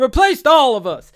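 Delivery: loud, high anguished cry; anguished shout. Retold or imitated. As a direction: cry it out in despair. Lotso quote, retold.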